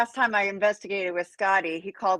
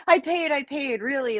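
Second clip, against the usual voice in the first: mild, higher-pitched protest; 7 semitones higher than usual. Retold or imitated. Woman retelling her plea at the ticket desk, protesting. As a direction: insist you really did pay, pitched up.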